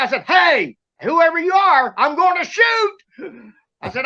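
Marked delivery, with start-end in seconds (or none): 0.2-3.0 high-pitched, drawn-out warning; retold warning shout. Boy warning an unseen thing in the woods, retold shout. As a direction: call out a threat, acting it out.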